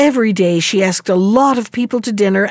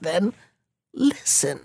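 hushed; 4 semitones lower, 8 dB quieter than usual. Acted. Husband leans in to confide.

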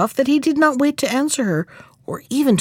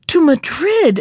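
startled higher-pitched exclamation; exclaiming; 6 semitones higher, 3 dB louder, slower than usual. A narrator's reading. Mother hen hears her chick is off to Madrid, exclaiming. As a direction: exclaim it in disbelief, pitch jumping up. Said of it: "exclaimed his mother".

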